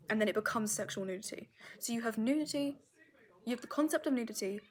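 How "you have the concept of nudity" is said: ordinary talk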